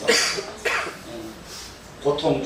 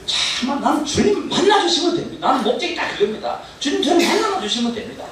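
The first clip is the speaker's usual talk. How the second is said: fervent prayer; 14 semitones higher, 4 dB louder than usual. Retold or imitated. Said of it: "만나주십시오."라고 하는 목적을".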